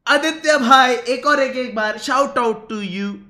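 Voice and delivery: loud high start, drawn-out finish; hearty shout-out. Real, not acted. Streamer giving a requested repeat shout-out, hearty shout-out. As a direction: call out grandly to a fan. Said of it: "भाई, एक short out दे दो यार".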